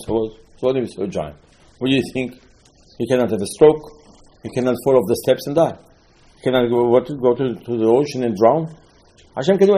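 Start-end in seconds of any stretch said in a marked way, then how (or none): none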